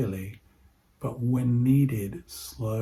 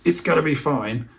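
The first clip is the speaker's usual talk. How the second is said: "It's gonna be fine" pushed higher and louder, tense; anxious reassurance; 5 semitones higher, 7 dB louder than usual. Shown as a demonstration. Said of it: "If that comes across as".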